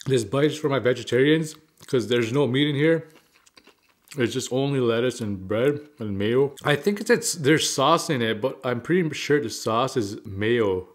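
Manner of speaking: chewing while talking; talking between bites; mouth full. Real, not acted. Seen 1: Chewing lips and full cheeks between phrases; eating before and after.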